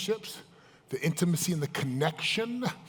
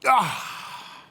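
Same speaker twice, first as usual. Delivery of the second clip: mock pained cry; 6 semitones higher than usual. Speaker acts out beating yourself up, mock pained cry. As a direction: cry out as if struck.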